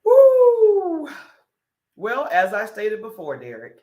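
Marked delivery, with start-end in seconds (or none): 0.0-1.1 sudden high loud whoop. Real, not acted. Reacting to a viewer comment with a loud high whoop. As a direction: let out a loud, high woo.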